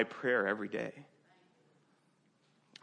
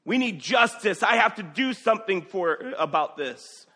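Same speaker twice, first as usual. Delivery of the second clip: much higher and louder indignant cry; David hearing of the stolen sheep, outraged; furious outrage; 9 semitones higher, 10 dB louder than usual. Retold or imitated. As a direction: demand justice in outrage. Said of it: "David was furious".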